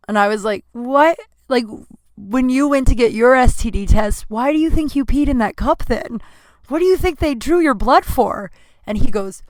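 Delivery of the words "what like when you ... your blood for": incredulous. Retold or imitated. Incredulous retort to boyfriend.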